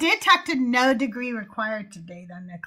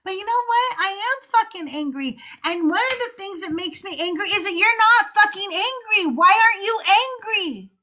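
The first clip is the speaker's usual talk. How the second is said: angry outburst; 8 semitones higher, faster than usual. Retold or imitated. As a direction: rant at believers, voice climbing. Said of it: "And then I thought".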